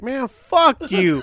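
retold angry curse. Storyteller cursing out the bouncer who took his money, indignant. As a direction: stretch the curse out, hammed up for laughs.

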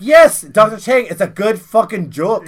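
starts high and loud, easing down; emphatic insistence. Retold or imitated. Caller answers doubtful doctor, loud emphatic yes. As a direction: assure him with force.

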